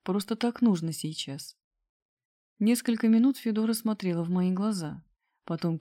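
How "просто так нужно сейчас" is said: usual speaking voice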